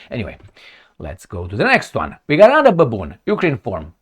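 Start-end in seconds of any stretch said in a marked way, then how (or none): none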